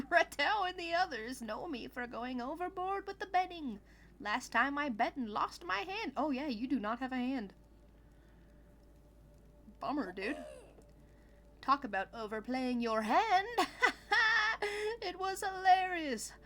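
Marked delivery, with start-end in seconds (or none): 0.1-6.1 lightly voiced game character, lilting; a hint of a boastful gambler; animated character read, somewhat higher; Clunko. Voices game's dialogue. 11.6-16.3 high, loud mock laugh in character; animated, higher character voice; teasing game character.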